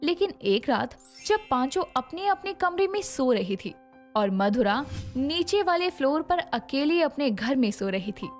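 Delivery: plain reading voice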